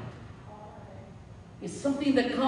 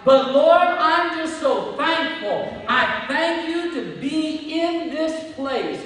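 loud, raised, rhythmic litany of thanks; fervent thanksgiving prayer; 6 semitones higher, 9 dB louder than usual. Shown as a demonstration. Preacher modelling a homeowner's thanks to God, fervent. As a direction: pray it out forcefully, each thanks hammered home.

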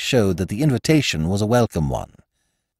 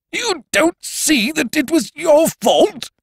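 high, slow, sputtering disbelief; indignant stutter; stuttering Ukridge; 4 dB louder, slower than usual. A narrator's reading. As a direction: stammer it back in a high, slow voice. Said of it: "stuttered Ukridge".

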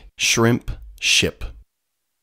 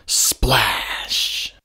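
1 whispered splash. A narrator's reading.

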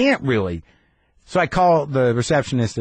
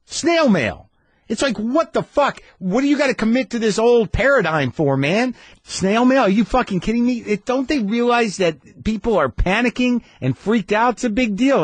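high-pitched indignant rant; exasperated, incredulous outburst; livid; 9 semitones higher than usual. Retold or imitated. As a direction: rail in disbelief, pitched way up. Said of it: "I was a little livid".

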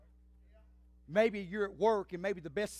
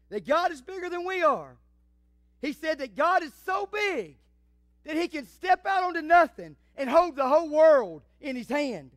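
put-on higher, louder, wonder-filled child; big childlike emphasis, pitched up; little girl's voice; 7 semitones higher, 10 dB louder than usual. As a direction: play a little girl amazed at what she heard. Little girl voiced in story.

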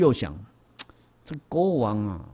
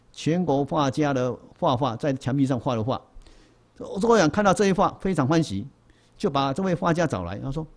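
ordinary talk, nothing marked

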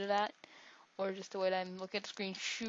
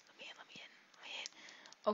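much softer whispered pleading; 10 dB quieter than usual. Pretend. Gamer begging the stage to let him in, whisper. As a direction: whisper the plea urgently.

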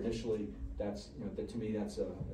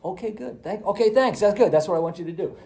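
higher, louder encouraging praise; eager praise; 7 semitones higher, 7 dB louder than usual. Retold or imitated. Tester praises the patient's partial answer, eager. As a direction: cheer them on, relieved. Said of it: "So it's like".

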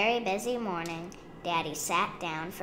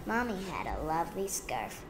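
ordinary delivery

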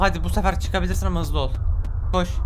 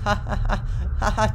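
higher, forced "ha ha ha"; mock laughter; 6 semitones higher than usual. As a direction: laugh sarcastically at a bad joke. Mock laugh at game's joke.

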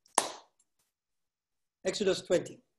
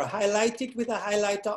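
ordinary talk, nothing marked

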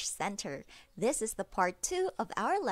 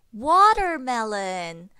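put-on reading to a toddler; 4 dB louder than usual. Drills kids in a singsong.

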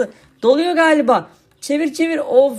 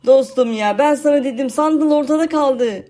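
usual speaking voice

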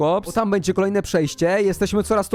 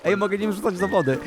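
ordinary delivery

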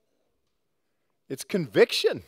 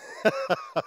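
a sudden high burst of laughter; quick real laugh; delighted laugh; 4 dB quieter than usual. Real, not acted. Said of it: "I'm sorry, but I love that".

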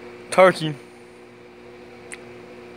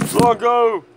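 calling the dog; 8 semitones higher than usual. Real, not acted.

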